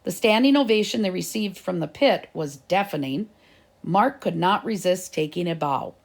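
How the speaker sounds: plain reading voice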